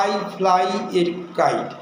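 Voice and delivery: each word spaced out, very slowly; slow dictation. Shown as a demonstration. Teacher dictating an example sentence to students, slow and deliberate. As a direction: read it slowly for the class to copy. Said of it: "যদি আমি বলতাম".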